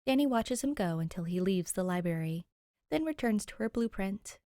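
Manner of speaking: ordinary delivery, nothing marked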